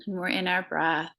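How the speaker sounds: ordinary delivery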